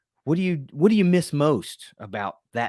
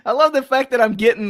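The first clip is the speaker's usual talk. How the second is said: laughing; 11 semitones higher, 5 dB louder than usual. Real, not acted. Host laughing at impression.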